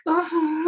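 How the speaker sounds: high-pitched cry at the ice pack; acted high "ah". Client handed ice pack after laser, acted high cry. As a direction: let out a mock high wail.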